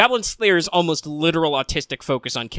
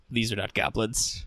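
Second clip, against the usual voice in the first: dropped, softer, toneless delivery; Goblin Slayer deadpan; 3 semitones lower, 7 dB quieter than usual. Acted. Literal-minded hero assessing the monsters, deadpan. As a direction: state it quietly with no reaction. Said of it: "just how fucking deadpan Goblin Slayer is".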